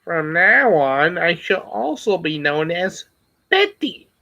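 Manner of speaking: slow, solemn line ending in a squeaky high Betty; quoting the movie. Retold or imitated. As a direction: build it grave then squeak the name. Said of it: "You saw that movie".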